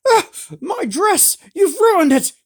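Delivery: very high, loud outraged shriek. A narrator's reading. Noble lady shrieking at the wizard for ruining her dress. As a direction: shriek over the ruined dress. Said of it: "Lady Ordith shrieked".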